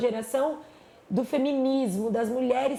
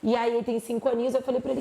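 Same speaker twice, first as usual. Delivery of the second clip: ordinary delivery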